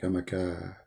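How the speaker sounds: low, soft, dazed cat talking to itself; drugged cat voice. Retold or imitated. Mocking the cat in the video, low and dazed. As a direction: sound lost and drugged, mumbling low. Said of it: "she go, like".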